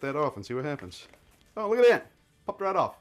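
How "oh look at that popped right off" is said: high, delighted oh as it pops free; a quick happy exclamation; pleased surprise. Real, not acted. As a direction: react like it came off easier than expected. Part pops off unexpectedly.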